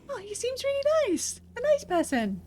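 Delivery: very high-pitched cooing; melting over someone likeable; charmed. Real, not acted. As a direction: gush in a high, sweet voice. Charmed by the new character.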